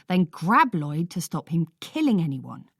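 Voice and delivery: ordinary delivery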